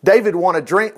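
ordinary delivery

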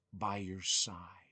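slow, breathy whisper; a soft whispered farewell; whispered promise. Retold or imitated. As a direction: whisper it like a mother's last promise. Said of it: "she used to always say this".

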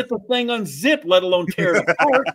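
high-pitched incredulous self-defense; exasperated protest. Real, not acted. Storyteller tells co-host he was too small, exasperated protest. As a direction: pitch way up, pleading your case. Comic protest to co-host's suggestion.

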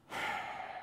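soft breathy sigh of disappointment; weary sigh. Retold or imitated. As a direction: let out a tired, soft sigh. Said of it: "he didn't say that, but I think he did".

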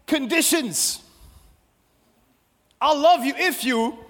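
high, loud, emphatic sermon delivery; full-force preaching. Acted. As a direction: punch out each phrase with long pauses.

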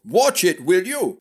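high, irritated snap; snapping. A narrator's reading. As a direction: snap a sudden warning. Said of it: "snapped Duane".

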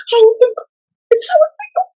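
very high, loud starstruck squeal; giddy fan. Retold or imitated. Young fan spots favorite ring announcer, giddy high squeal. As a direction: squeal like a kid in a candy store. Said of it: "like a little girl in a candy store".

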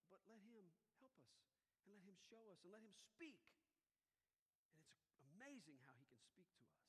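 usual speaking voice

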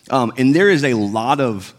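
normal talking voice

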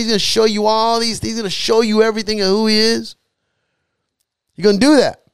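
high-pitched, building sermon delivery; fervent preaching. Real, not acted. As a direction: preach it insistently, piling up each promise. Preaches with rising fervour.

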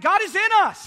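high, loud burst of joy; excited exclamation. Real, not acted. Preacher exulting to congregation, loud and high. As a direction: cry it out in triumph. Preacher's climax, much higher, louder.

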